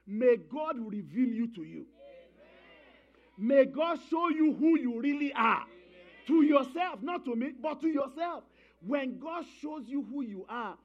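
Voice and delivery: loud, slow, high shouted blessing; full-force preaching. Acted. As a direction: call it out over the whole church.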